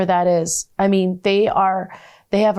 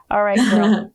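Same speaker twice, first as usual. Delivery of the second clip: a giggly, affectionate farewell; laughing goodbye. Real, not acted.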